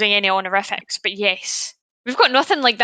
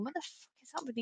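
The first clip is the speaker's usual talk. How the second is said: muttered American; 23 dB quieter than usual. Retold or imitated. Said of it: "they're probably listening going".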